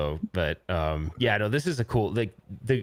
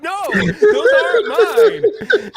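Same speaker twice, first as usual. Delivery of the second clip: very high, loud, slow, laughing protest; laughing denial; 11 dB louder, much slower than usual. Retold or imitated. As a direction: deny it while cracking up. Denying in the retold call.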